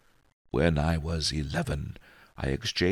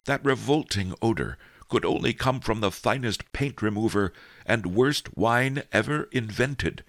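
usual speaking voice

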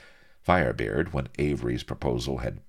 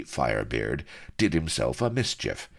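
ordinary delivery

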